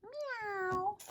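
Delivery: high soft meow; cat meow. Acted. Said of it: "said Cat".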